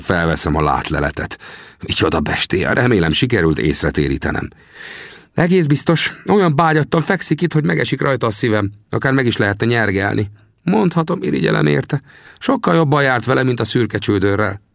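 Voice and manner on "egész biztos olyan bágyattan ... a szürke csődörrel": put-on high, teasing little old man's voice; old trapper Sam. Sam teasingly asks the narrator about his bones, high old voice. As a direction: needle the greenhorn from a high perch. Sam's voice in dialogue.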